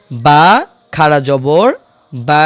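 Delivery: বা drawn out for one full second; long vowel. Shown as a demonstration. Teacher demonstrating the long বা for students. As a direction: stretch the বা, one beat long. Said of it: "এক আলিফ বা এক second টান হবে".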